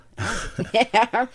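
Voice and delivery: loud delighted laugh; bursting laugh. Real, not acted. Reacting to tasting the food.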